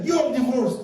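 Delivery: high-pitched, hammed-up angry verdict; heated pronouncement. Enraged husband pronounces divorce on his wife, mock anger. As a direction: blurt it at the peak of a mock row. Husband snaps in acted quarrel.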